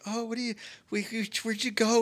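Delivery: a hint of chirpy friends looking for her; friends calling. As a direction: mimic the friends, quick and soft. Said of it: "they're like".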